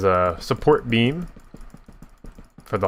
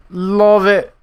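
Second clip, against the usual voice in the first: excited; 10 semitones higher, 6 dB louder than usual. Acted. Repeats praise, much higher.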